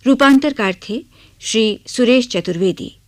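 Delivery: ordinary talk, nothing marked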